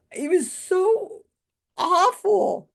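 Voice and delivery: high, slow and drawn-out; exasperated emphasis. Real, not acted. Telling viewers the trick bombed, drawn-out high dismay. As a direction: let each awful sag with disbelief. Retelling the magic trick bombing.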